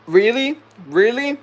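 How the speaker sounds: high, rising disbelief; incredulous. Retold or imitated. Worker told to go home, incredulous.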